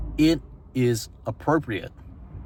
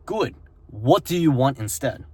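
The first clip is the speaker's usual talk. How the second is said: ordinary delivery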